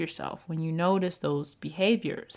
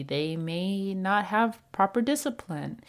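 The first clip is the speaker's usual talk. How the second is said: ordinary talk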